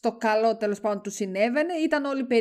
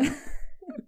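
high laugh, dying of it; helpless high giggling; helpless laughter; 7 semitones higher, 8 dB quieter than usual. Real, not acted. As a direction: laugh till you can't take it. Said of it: "Δεν μπορώ, πεθαίνω".

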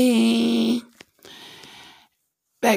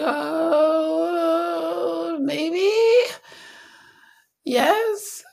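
higher, drawn-out hesitant yes; mock reluctant consent; reluctant giving in; 10 semitones higher, much slower than usual. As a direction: give in with a sigh. God grudgingly giving in.

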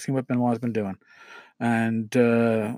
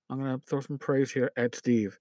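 softer, dropped-down aside; quieter aside; 8 dB quieter than usual. Real, not acted. Host adds a nod to Steve, softer. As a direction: tuck it away under the breath. Repeats line, swaps trolls for Steve.

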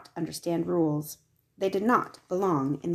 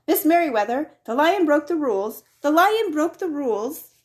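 louder, higher, hurried alarm; urgent tattling call; 9 semitones higher, 7 dB louder than usual. A narrator's reading. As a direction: tell on the lion as he rushes down the hall. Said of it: "he called as he walked".

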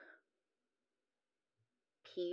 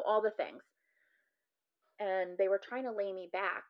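ordinary delivery

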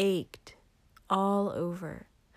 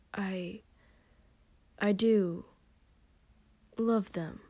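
awkward; slower than usual. Acted. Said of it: "he said a little awkwardly".

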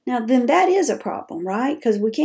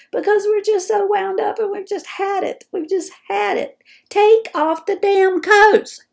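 fed-up outburst; 10 semitones higher than usual. Pretend. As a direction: let the frustration boil over into a shout. Caregiver fed up, exploding.

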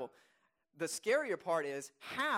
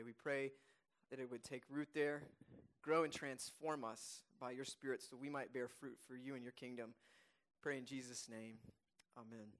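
low, subdued praying voice, softening to the amen; gentle corporate prayer, settling lower; spoken prayer; 4 semitones lower, 4 dB quieter than usual. Real, not acted. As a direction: pray quietly on behalf of the congregation. Leads a prayer.

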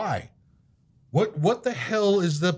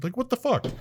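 ordinary delivery

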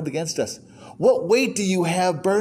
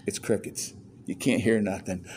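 mock hush; 9 semitones lower, 9 dB quieter than usual. Silent churchmen, half asleep.

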